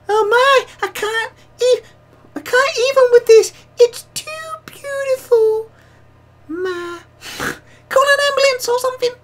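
absurdly high, loud squeal; stupid high voice. Pretend. Streamer voicing Celeste's gushing message, silly very high voice. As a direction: squeak it out as high as you can, mock-overwhelmed. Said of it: "most stupid high voice I can do".

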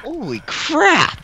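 high slow stunned exclamation; stunned disbelief; shocked. Real, not acted. Explosion aftermath.

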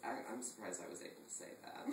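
usual speaking voice

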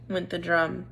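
usual speaking voice

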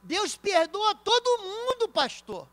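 mock congregant. Mocking a congregant's claim.

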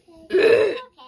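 sharp loud alarmed gasp. Real, not acted. Mom gasps loudly at her sick daughter. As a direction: suck in air in shock. Mom sees child vomit.

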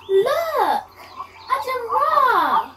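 gasp, then a high thrilled cry; excited discovery. Acted. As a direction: point it out with delight. Spots giraffe on safari.

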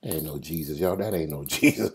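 low, very soft dismissive aside; doubting aside. Acted. Doubter to the others in the boat, low hushed disbelief. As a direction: say it under your breath to your companions.

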